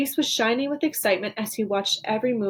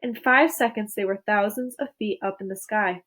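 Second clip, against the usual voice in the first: ordinary delivery, nothing marked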